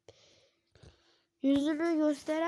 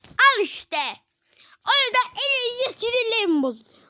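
high, loud exasperated cry; frustrated outburst; 9 semitones higher, 7 dB louder than usual. Real, not acted. Kid venting after losing the round, high and loud. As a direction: vent your frustration shrilly.

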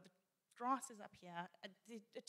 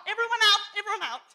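loud, higher call to get out; urgent command; 9 semitones higher, 18 dB louder, faster than usual. Retold or imitated. Family lightening the stuck car, loud urgent order. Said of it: "So we're like".

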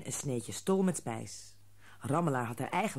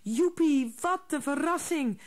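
much higher, louder cheer; joyful shout; 12 semitones higher, 5 dB louder than usual. A narrator's reading. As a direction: whoop with joy. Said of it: "riep Rammelaar". (Rammelaar juicht hoog en luid)

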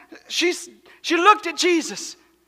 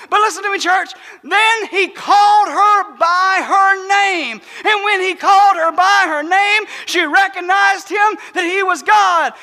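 preaching at full force; 2 semitones higher, 4 dB louder than usual. Acted. Pastor to his church on Easter, full-force preaching. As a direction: build the congregation up with every word.

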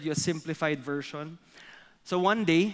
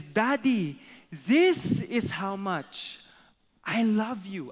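put-on higher, drawn-out child rabbit; little rabbit; 5 semitones higher, 4 dB louder, slower than usual. Little rabbit on tiptoes to daddy, high and slow. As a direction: reach up high with the voice, stretching THIS. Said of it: "little rabbit stands up".

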